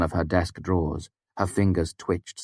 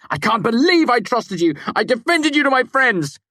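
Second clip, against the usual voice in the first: much higher, louder betrayed shout; angry shout; 6 dB louder than usual. A narrator's reading. Kvothe yelling at Devi over broken trust, angry. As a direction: yell it, stung by betrayal.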